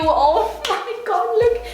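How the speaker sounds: high, drawn-out squeal with a laugh; giddy amazement; excited gushing. Real, not acted. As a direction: gush in a rising, stretched voice. Gushes over brand new bargain.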